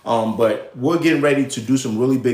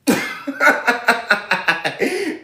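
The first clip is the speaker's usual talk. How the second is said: high amused cackle at his own jab; amused laugh; 9 semitones higher than usual. Real, not acted. Host laughing at his own joke to viewers, amused cackle. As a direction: let out a real tickled laugh.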